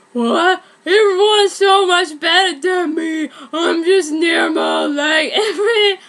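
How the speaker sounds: loud whiny cry of despair; upset girl screaming about being normal. Acted. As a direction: wail it, voice breaking at the end. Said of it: "said and screamed".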